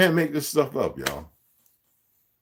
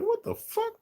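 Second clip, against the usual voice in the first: mock shocked reaction; 6 dB quieter than usual. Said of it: "They're like".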